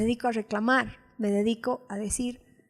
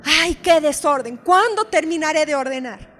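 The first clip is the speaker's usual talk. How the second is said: louder, fed-up groan at the mess; exasperated complaint; 5 semitones higher, 8 dB louder than usual. Retold or imitated. Preacher recalls griping at her office mess, exasperated. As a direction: complain about the endless mess. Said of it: "Siempre digo".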